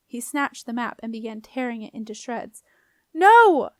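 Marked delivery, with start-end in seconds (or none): 3.1-3.7 high, very loud cry of protest; full-voiced desperate shout; yell. A narrator's reading. Girl seeing her map torn, yelling. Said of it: "yelled Jenna".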